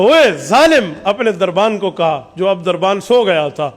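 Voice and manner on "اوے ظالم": louder, higher indignant shout; angry cry. Retold or imitated. Wounded chief shouting at his guard after the attack. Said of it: "تو اس نے کہا".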